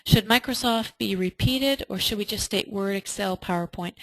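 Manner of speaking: normal talking voice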